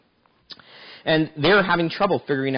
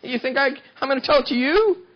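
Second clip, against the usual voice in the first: indignant Samson; 12 semitones higher than usual. Acted. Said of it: "He said".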